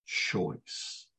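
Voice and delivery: "choice" breathed out soft and voiceless; emphasized word. Shown as a demonstration. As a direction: hush the word "choice" to make it land. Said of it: "I want to emphasize the word".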